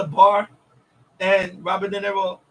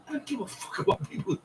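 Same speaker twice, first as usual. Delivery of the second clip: hushed menace; 5 semitones higher, 9 dB quieter than usual. Retold or imitated. Gangster quote, voiceless.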